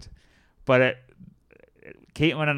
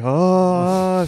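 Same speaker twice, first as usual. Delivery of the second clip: long, raised, louder "oh" of exasperation; mimicked agitated groan; 6 semitones higher, 5 dB louder than usual. Imitating a fidgety woman in the line, agitated groan. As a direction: groan it out, imitating someone itching all over. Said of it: "she's rubbing her arms and pulling her hair".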